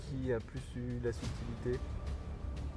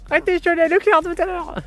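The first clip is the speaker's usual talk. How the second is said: squeaky silly voice; 11 dB louder, faster than usual. Pretend. Silly high voice to provoke laughs.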